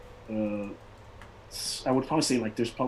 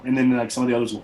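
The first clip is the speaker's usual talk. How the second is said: normal talking voice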